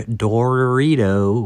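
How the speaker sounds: "Dorito" with a long drawn-out first syllable; extra door. Acted. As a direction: stretch the door in "Dorito", very slowly. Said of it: "but with extra door in them".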